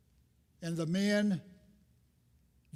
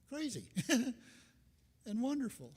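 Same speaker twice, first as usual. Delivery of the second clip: high, slow words broken by a real laugh; laughing delight; 7 semitones higher, much slower than usual. Real, not acted. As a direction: laugh through crazy and wonderful. Laughing while praising the place.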